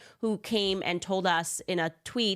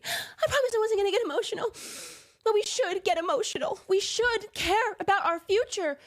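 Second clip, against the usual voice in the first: high, teary mock of a weepy speech; 14 semitones higher than usual. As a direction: overplay the tearful plea, much higher. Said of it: "like, poor acting".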